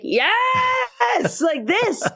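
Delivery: sudden high excited shout of approval; excited cheer. Retold or imitated. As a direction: cheer her on like a thrilled fan. Cheering a classmate's wall scream.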